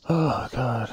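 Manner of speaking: high, loud exasperated "oh god"; loud frustrated groan. Real, not acted. Gamer fuming over wasted effort, loud exasperated groan. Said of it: "I'm so mad".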